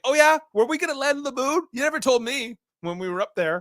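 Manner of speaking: high, loud mock indignation; imitated annoyance. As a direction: play an unhappy colleague who was left out. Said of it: "Gordo's not too happy".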